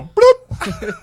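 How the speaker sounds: very high cartoon bloop; silly bloop noise. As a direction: pop out a squeaky bloop. Silly noise when approaching.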